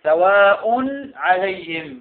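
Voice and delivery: loud, drawn-out "سواء" said "sawaa-un", clear n before "عليهم"; tanween made clear. Shown as a demonstration. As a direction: sound the final "-un" of "سواء" clearly, then "عليهم". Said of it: "ظهر".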